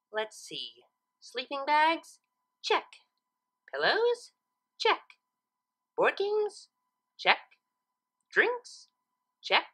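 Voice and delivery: rising pitch on each item, clipped check; ticking items off a checklist; Twilight checking her list. Acted. Twilight ticks off her sleepover list, voice climbing.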